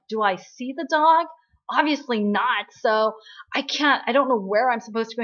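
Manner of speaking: irritated retort. Retold or imitated. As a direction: snap back sarcastically, fed up. Jung-won complains, annoyed.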